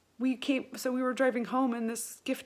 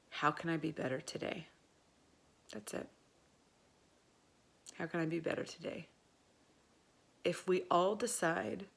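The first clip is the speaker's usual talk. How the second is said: low, soft, earnest closing words; heartfelt sincere; 5 semitones lower, 5 dB quieter than usual. Real, not acted. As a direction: speak a quiet, sincere blessing to listeners. Heartfelt closing prayer and blessing.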